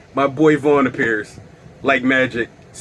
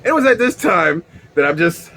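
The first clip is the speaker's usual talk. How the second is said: ordinary talk, nothing marked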